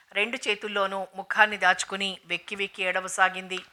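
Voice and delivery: plain reading voice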